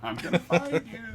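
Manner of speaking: lightly voiced obsessive clinger; hint of a playful, clingy threat; clingy movie stalker. Friend jokes he is the clinger from Wedding Crashers, playful menace. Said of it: "I'm the, the Is the Filcher".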